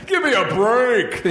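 high, drawn-out mock heckle; hammed-up, slow exasperated protest; heckling voice. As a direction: groan it out like an audiobook heckler. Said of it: "there's other voices going like".

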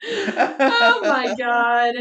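slow, drawn out through laughter; laughing. Real, not acted. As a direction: let the laugh stretch each word. Overcome by laughter.

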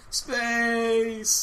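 high, excited robot yelp; jerky animatronic voice. Retold or imitated. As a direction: squeal it like a glitchy robot. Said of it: "that malfunctioning robot just going".

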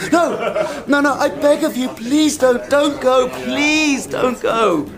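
high desperate begging not to be left; pleading. Acted. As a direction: beg them to stay, close to panic. Begs company not to leave.

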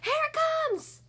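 high, loud, excited call; shout. A narrator's reading. Cow sees the egg about to hatch, excited shout. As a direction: shout it out to the whole herd. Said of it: "shouted one of the cows".

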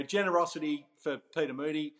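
usual speaking voice

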